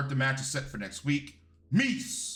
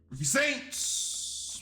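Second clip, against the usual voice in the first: drawn-out hype call; 9 semitones higher, much slower than usual. Real, not acted. Calling out wrestler's entrance.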